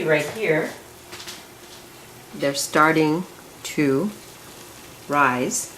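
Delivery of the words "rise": normal talking voice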